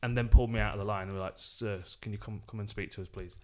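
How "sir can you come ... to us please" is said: polite quiet officer. Retold or imitated. Security politely pulling him aside.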